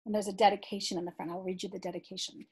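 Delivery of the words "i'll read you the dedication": ordinary delivery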